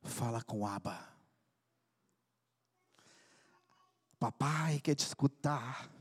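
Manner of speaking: low, soft and slow, as God speaking gently; hushed divine voice inviting the son to pray; quiet tender voice of the Spirit; voice of the Holy Spirit. Acted. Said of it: "o Espírito Santo diz".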